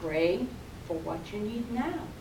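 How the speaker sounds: a gentle, quiet inner voice; calm inner voice. Acted. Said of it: "The voice said one more time".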